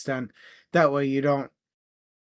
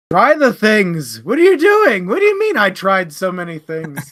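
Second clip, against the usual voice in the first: exasperated yell; 5 semitones higher, 9 dB louder than usual. Retold or imitated. Book club reviewer yelling at a character, exasperated shout. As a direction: yell at her to just try the things.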